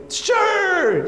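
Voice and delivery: high shouted yes; kids' eager shout. Retold or imitated. Elementary kids shouting yes to singing together. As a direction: yell it like eager little kids. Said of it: "And they go".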